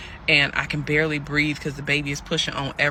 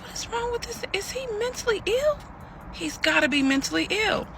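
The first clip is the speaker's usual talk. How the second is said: very high, hushed incredulity; stunned disbelief; 3 dB quieter than usual. Retold or imitated. Woman sitting alone after his call, high hushed disbelief. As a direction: think aloud in a tiny high voice.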